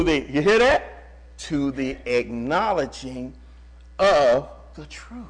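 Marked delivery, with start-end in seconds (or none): 1.4-5.2 acknowledging drawn out slow, each word weighted; slow emphasis. Shown as a demonstration. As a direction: lean on every word of the phrase, slowly. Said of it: "we can't put enough emphasis on that".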